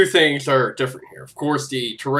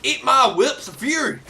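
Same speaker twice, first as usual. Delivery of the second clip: high-pitched mock attack line; hammed-up battle cry; 10 semitones higher than usual. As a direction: throw it out as a cartoon finishing move. Playful battle cry in game.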